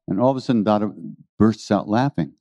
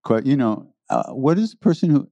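ordinary talk

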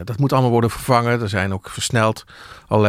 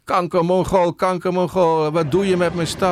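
imitated angry yell; 8 semitones higher than usual. Journalist quoting an inspector cursing at a demonstrator, imitated high-pitched yell. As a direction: imitate a cursing, raging officer in a raised pitch. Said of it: "En die roept".

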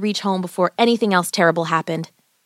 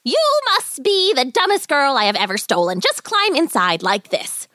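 angry witch; 5 semitones higher than usual. A narrator's reading. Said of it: "The witch shrieked with anger".

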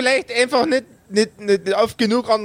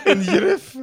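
copying "In Griff" in a Bavarian accent, a bit higher; mock Bavarian "In Griff", repeating the friend; imitating Bavarian accent; 4 semitones higher than usual. Shown as a demonstration. Player copying the accent he was just shown. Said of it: "wie sagt man in dem Akzent bitte Griff".

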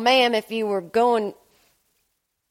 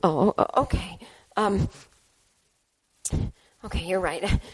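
normal talking voice

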